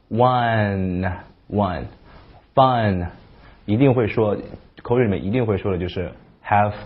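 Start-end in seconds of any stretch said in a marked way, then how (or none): 0.1-3.1 one and fun very slowly, short uh vowel; slow drill. Shown as a demonstration. Teacher leading a slow pronunciation drill. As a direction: stretch out one, one, fun for practice. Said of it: "我们来练几个".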